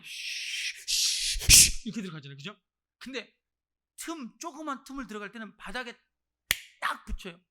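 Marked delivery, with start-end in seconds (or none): 0.0-1.9 voiceless "shh" imitating a snake sliding; imitated slither, repeated hushing noise; slithering snake sound. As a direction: make the swish of a snake slipping through. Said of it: "이렇게 뱀이 이렇게 하잖아요".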